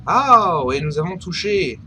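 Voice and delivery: ordinary talk, nothing marked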